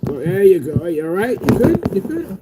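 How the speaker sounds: high, rising coddling voice; mock babying. Vlogger checking on crying haters, mock babying. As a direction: coo loudly as if to a toddler.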